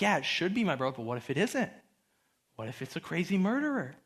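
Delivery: low, hushed anxious self-talk; anxiety. Retold or imitated. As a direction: quietly retell a nagging worry. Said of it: "I remember that anxiety".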